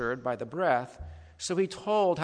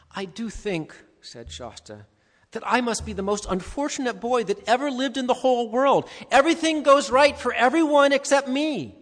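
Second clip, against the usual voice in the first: put-on self-pitying boy; 9 semitones higher, 8 dB louder than usual. A narrator's reading. Shasta complains self-pityingly.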